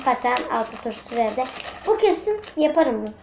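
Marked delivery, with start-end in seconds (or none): none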